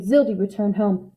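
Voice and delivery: ordinary delivery, nothing marked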